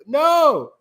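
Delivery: a high, loud cry of no; anguished no. Retold or imitated. Fan reacting to Drax's line, crying out loud. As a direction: wail it out in mock despair. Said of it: "I was like".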